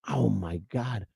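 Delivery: soft, hushed imitation of an appalled wife; understated retold dismay; mortified wife. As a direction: quietly play the embarrassed wife. Wife mortified by his outfit, retold.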